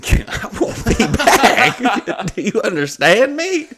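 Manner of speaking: high, slow, laughing through the words; laughing refusal. Retold or imitated. Comedian telling the owner he won't return, laughing. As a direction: laugh it out in disbelief. Refusing to ever come back.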